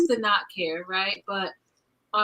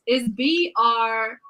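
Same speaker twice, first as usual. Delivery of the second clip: slow letter-by-letter spelling; spelling out slowly; slower than usual. Shown as a demonstration. Reading granny's card, spelling the name out slowly. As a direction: read each letter out slowly and deliberately. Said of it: "It goes".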